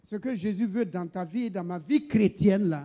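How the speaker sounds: loud, ringing sermon cadence, one phrase at a time; forceful preaching. Acted. Preacher calls out to his congregation in a loud, ringing voice. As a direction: preach it out to the church, pausing for the interpreter.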